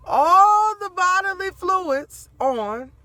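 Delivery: high, loud, slow laugh-cry; high wailing laughter. Real, not acted. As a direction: laugh till it breaks into a wail. Laughing-crying while telling.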